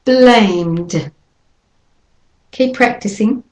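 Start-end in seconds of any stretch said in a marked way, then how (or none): 0.0-1.1 Blamed, M running straight into D, one syllable; blamed with a final d. Shown as a demonstration. Teacher models blamed for learners. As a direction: hum the M then close on D in Blamed. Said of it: "And again".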